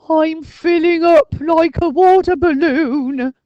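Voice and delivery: high, loud, slow ghostly moan; moaning Arthur. A narrator's reading. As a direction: drag the complaint out. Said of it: "moaned Arthur".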